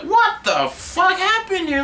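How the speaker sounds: hammed-up shocked worker; mock angry boss. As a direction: parody the furious owners. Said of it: "They're like".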